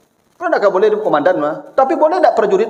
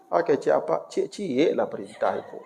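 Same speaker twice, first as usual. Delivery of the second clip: usual speaking voice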